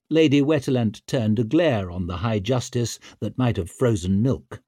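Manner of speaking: ordinary delivery, nothing marked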